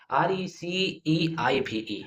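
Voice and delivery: drawn-out dictation; slow letter spelling. Shown as a demonstration. Teacher to students, spelling slowly letter by letter. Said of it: "spelling out".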